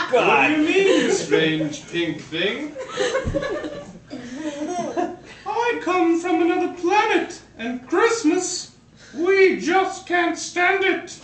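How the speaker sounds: high alien voice through giggles; laughing high cartoon alien; alien villain laughing. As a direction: sneer at Santa, half laughing.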